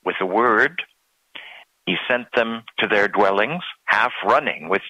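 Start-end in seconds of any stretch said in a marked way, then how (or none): none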